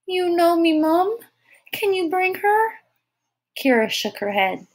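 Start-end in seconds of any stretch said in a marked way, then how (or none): 0.1-2.7 put-on high, plaintive toddler voice; pleading small child. A narrator's reading. Tyke asks Kira for her mother, pleading high child's voice. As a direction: plead like a little one wanting mum.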